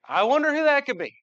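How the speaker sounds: high, loud mock-innocent wonder; sarcastic. Dad at Lowe's pretending not to know his kid, sarcastic. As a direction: feign innocence about whose kid it is, loudly.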